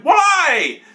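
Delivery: a high, loud mock yell of why. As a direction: yell it in outraged disbelief. Retold outburst at the prisoner.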